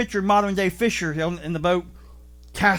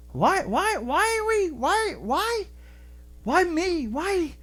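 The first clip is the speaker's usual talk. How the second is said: plaintive why me; 8 semitones higher, 3 dB quieter, slower than usual. Retold or imitated. Voicing a lost man's plea.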